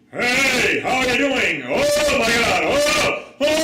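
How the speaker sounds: high, loud excited call; emotional calling out. Shown as a demonstration. Teacher showing the emotional voice by calling out. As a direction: cry out with delight to a friend. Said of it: "Your natural".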